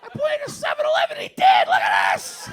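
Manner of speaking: loud, high drunken shouting in imitation; hammed-up drunk woman yelling; drunk proud boasting. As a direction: show off triumphantly at a full shout.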